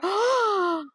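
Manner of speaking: shocked gasp. Real, not acted. Shocked reader gasps.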